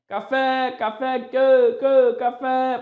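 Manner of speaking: loud, high, stretched-out dog call. Pretend. Teacher pretends to call the dog Café, loud and high. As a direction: sing out the pet's name as if summoning it. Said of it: "costumo brincar de chamar o cachorrinho".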